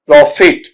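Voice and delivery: "प्रॉफिट" with a short "fit" ending; correct pronunciation. Shown as a demonstration. Teacher saying it a third time, "प्रॉफिट" as pro-fit, correct pronunciation. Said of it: "इस तरीके से".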